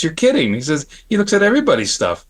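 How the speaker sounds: incredulous. Retold or imitated. Friend's incredulous reaction retold.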